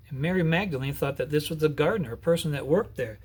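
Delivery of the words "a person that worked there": ordinary delivery, nothing marked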